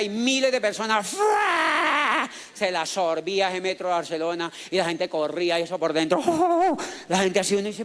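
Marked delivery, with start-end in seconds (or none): 1.1-2.3 big shouted whoosh; imitated dragon whoosh. Imitates the dragon sucking people in. 6.3-6.8 high "oh" from the swallowed crowd; high-pitched mock wail; imitated crowd cry. Imitates crowd swallowed inside dragon.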